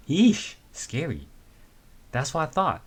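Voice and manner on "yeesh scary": slow, drawn-out, higher yeesh; a hammed-up shudder at the story; mock scared. Reacting to the frantic call.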